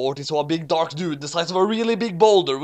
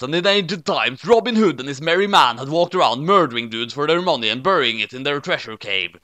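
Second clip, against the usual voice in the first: ordinary delivery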